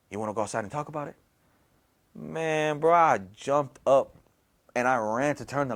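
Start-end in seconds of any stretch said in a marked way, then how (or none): 0.1-1.2 low, soft and hurried; hushed invitation. Retold or imitated. Mysterious voice invites him out at night, hushed. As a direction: speak low and secretive, quickly. Mysterious voice speaks to sleepless man.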